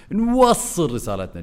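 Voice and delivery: نوصل stretched high, then dropping. Shown as a demonstration. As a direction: push the pitch up on نوصل, then let it fall. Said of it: "وعرلت النبرة، ورديت نزلتها".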